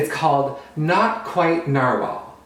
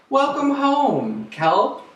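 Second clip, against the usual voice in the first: higher, slow, glad greeting; narwhal friends' welcome; 10 semitones higher, much slower than usual. Acted. Narwhal friends greet Kelp coming home, high and slow. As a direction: welcome him back fondly.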